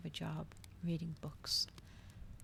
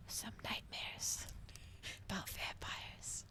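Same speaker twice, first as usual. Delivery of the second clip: slow, much softer creepy whisper; spooky whisper; 3 semitones higher, 5 dB quieter, slower than usual. Pretend. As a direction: hush it to tease them with nightmares. Teasing listeners with nightmares.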